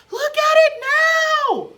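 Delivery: very high, very loud squeal; a furious little hamster shrieking; squeaky shout. A narrator's reading. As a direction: scream it at the human in frustration. Hamster yelling frustrated.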